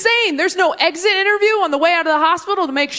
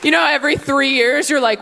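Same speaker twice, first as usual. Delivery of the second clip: ordinary talk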